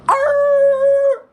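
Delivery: high loud shout arr; battle cry. Retold or imitated. Mom charging at the girl, wild yell.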